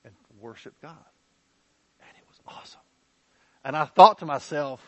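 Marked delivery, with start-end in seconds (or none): 2.0-2.8 hushed, breathless awe; awed whisper. Real, not acted. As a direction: whisper it in wonder. Awed whisper about worship.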